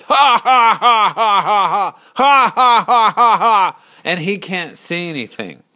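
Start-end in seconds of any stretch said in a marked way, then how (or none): 0.0-3.8 loud, high, hammed-up ha-ha-ha; mock crowd laughter. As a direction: boom out a big jeering fake laugh. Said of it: "thousands of people laughing, laughed".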